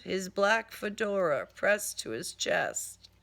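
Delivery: plain reading voice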